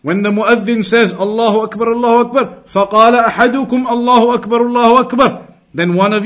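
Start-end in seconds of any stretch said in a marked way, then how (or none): none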